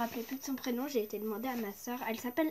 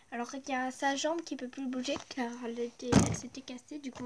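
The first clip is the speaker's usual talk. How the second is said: ordinary delivery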